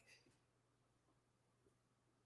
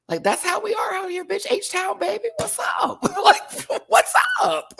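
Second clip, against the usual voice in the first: much higher, hyped and laughing; hometown hype; 5 dB louder than usual. Real, not acted. Houston fan hyping H-Town, high and excited. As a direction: cheer on your city.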